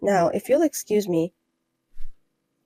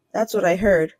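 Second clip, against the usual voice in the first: normal talking voice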